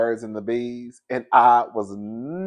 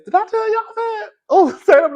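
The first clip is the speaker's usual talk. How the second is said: much higher, squeaky excited question; excited squeak; 6 dB louder than usual. Real, not acted. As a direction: squeal it with glee. Excited aside to viewers.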